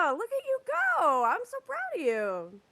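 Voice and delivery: high, sing-song praise; high praising baby talk. Pretend. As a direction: praise her like a proud parent. Praising like to a child.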